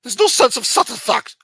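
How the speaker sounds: loud, high hammed-up British accent; shouted mock-British delivery. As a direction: put on an outraged Brit, loud and high. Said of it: "they said that in a British accent".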